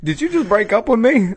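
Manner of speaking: high, shocked mock Adam; hammed-up high whine, hurt and baffled. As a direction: squeal it like a dumped lover. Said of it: "Be like".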